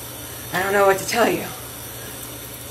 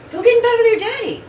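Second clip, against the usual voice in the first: much higher, fast coaxing; high urging pet voice; 13 semitones higher, much faster than usual. Real, not acted. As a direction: shoo the dog off to bed in a high voice.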